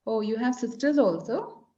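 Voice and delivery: ordinary delivery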